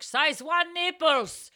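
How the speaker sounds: put-on loud, high, slow Russian-accented call; heavy Russian accent. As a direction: imitate a Russian shop clerk calling out. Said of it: "she has a heavy Russian accent".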